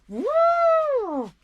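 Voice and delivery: high, loud drawn-out "woo"; one long, high-pitched holler; imitated grandson's whoop. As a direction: whoop like an excited little grandchild. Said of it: "As Callum would say".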